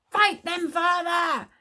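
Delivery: high, excited shout. A narrator's reading. Boy urging his father to fight, shouting. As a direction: yell it out high to urge him on. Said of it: "shouted Tsar".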